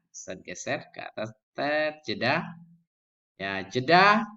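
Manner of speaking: ordinary delivery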